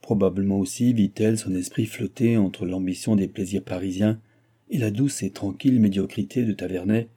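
ordinary delivery

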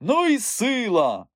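high, drawn-out crowd cheer; cheering shout. A narrator's reading. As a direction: call it out in slow, high admiration. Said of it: "чулося звідусіль". (захоплені вигуки натовпу)

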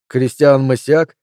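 plain reading voice

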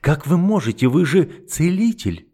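high, slowing horrified reproach; a touch of shocked dread; horrified girl. Acted. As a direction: appeal to his conscience. Frederika's horror.

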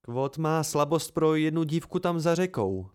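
ordinary delivery

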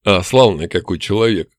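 low, rough orc voice; rough-voiced orc. A narrator's reading. Orc appraising the captured human, rough and low. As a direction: size him up in a coarse orc voice. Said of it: "грубым голосом оценил орк".

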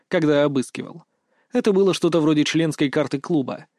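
ordinary delivery

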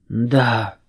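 hoarse, choked "да"; strangled croak. A narrator's reading. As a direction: squeeze it out in a rasp. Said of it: "сдавленно прохрипел хомяк".

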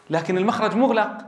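usual speaking voice

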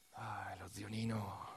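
slow, soft whisper. Real, not acted. Storyteller hushing the audience about uncle Nino, whispered. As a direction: breathe it out, nearly voiceless.

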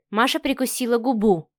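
ordinary delivery

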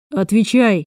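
a mildly barked demand; barked order. A narrator's reading. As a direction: bark it briefly, without a full shout. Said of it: "рявкнул Крейг".

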